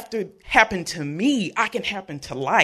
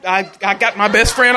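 normal talking voice

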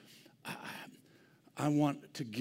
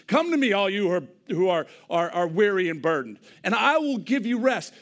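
full-voiced preaching cry; loud preached invitation; 4 semitones higher, 8 dB louder than usual. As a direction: proclaim it loudly to the room.

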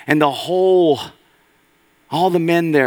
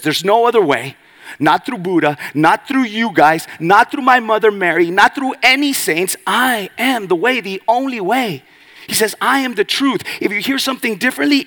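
rising louder and higher with each not through; building preaching; 9 semitones higher, 6 dB louder than usual. Real, not acted. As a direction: climb from firm to full-force proclamation. Jesus' words preached building.

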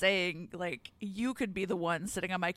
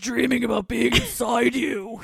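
loud growling romance line; growly wrestler voice; 2 semitones higher, 12 dB louder than usual. Pretend. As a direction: growl like a brute in a romance novel. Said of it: "get Braun Strowman to do these".

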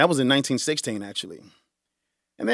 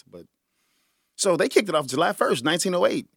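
ordinary talk

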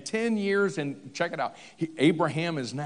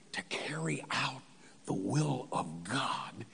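near-whisper, slowing down; hushed emphasis; 3 semitones lower, 9 dB quieter than usual. Real, not acted. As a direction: drop to a solemn whisper. Dramatic hush in sermon.

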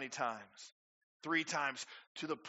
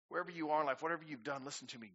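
usual speaking voice

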